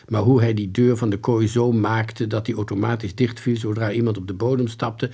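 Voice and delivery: ordinary delivery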